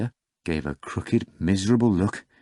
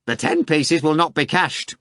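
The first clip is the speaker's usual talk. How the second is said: very high mocking cackle of a question; high sneering rotten voice; 8 semitones higher, 5 dB louder than usual. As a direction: jeer at him from the study. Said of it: "the same voice put in".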